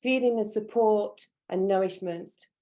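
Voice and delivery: measured meditation voice, long silences between instructions; guided meditation. Real, not acted. Teacher grounding students at a training's opening, guided meditation. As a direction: guide their breathing in calm, spaced phrases.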